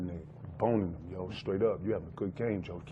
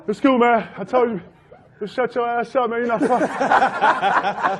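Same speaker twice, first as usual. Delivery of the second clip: higher, louder mock scold with laughter; laughing scold; 8 semitones higher, 5 dB louder than usual. Teammate scolding player for taunting Jordan, laughing. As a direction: tell him to shut up, laughing. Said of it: "He like".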